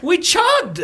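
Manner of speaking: high triumphant cheer; excited cheer. Real, not acted. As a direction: celebrate the win. Celebrating the chug.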